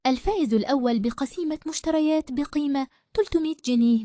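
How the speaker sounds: high, grand winner announcement; booming prize announcer. Acted. (مذيع جوائز بصوت عالٍ)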